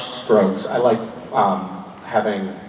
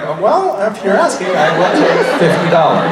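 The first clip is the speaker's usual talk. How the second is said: higher-pitched teasing imitation of a quick haggler; mock eager opportunist; 6 semitones higher, 6 dB louder than usual. Teasing the audience member who asked for money, mock eager. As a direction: lightly mimic them, pitched up, laughter around it. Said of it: "You're like".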